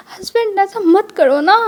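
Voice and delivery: drawn-out pouty protest; whiny plea. Acted. Wing whines at nose pinch. (ऊँची आवाज़ में धीमे-धीमे रूठकर मना करना)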